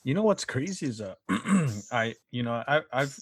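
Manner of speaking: normal talking voice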